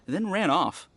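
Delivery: ordinary delivery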